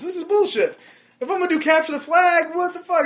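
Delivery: high, loud indignant rant; mock outrage. Real, not acted. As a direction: rant like a cheated player. Ranting about the game rules.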